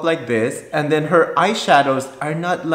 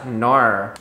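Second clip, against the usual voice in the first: Aussie "no" shown as "nor"; Australian accent; 4 semitones lower than usual. Shown as a demonstration. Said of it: "pronounced as nor".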